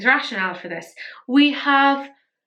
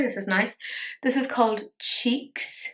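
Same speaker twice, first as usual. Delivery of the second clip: ordinary delivery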